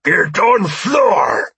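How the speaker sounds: very slow, high, loud roar; drawn-out, full-force alien shout; alien's shout. A narrator's reading. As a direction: bellow the order as an alien captor. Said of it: "Gregor shouted".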